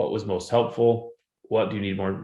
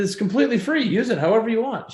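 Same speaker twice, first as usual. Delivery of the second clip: much higher and louder, urgent reassurance; retold pleading that she need not pay; insistent; 4 dB louder than usual. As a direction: hurry to set the student straight, voice up high. Retelling reassurance to a student.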